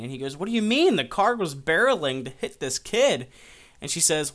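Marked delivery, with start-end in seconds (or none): 0.4-3.3 loud, high-pitched protest; acted incredulous outburst; indignant disbelief. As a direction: object in outraged surprise. Hero disputes pointless death.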